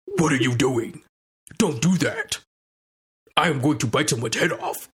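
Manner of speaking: imitating Tom Hardy's Venom, lightly voiced; a hint of a gravelly monster growl; half-hearted Venom impression; mock Venom voice. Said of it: "his Venom voice is atrocious".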